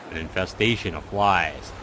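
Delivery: ordinary delivery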